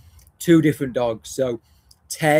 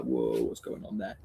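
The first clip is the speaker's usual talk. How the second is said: much lower, softer startled whoa; a low, hushed guard-dog whoa; wary dog voice; 14 semitones lower, 10 dB quieter than usual. Retold or imitated. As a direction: voice the dog going on alert. Voicing wary German Shepherd.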